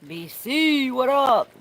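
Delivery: loud, high-pitched British greeting. Pretend. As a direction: call it out high in a playful British accent. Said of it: "I said that in a British voice".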